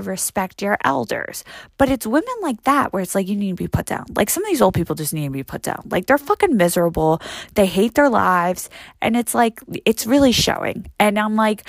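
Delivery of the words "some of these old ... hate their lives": ordinary talk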